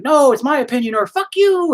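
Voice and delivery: high, loud caricature of a stubborn wife; mock stubborn wife. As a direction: toss it off like a dig at her stubbornness. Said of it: "And she's like".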